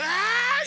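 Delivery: sudden high loud shout; exorcist war cry. Retold or imitated. Preacher voicing an exorcist spotting the devil, loud cry. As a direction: burst out like a charging exorcist. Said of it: "la pose del liberador".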